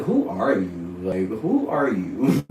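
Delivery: playful disbelief, drawn out on the repeat; incredulous questioning. Retold or imitated. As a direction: act out being baffled by mom. Retold baffled question to mother.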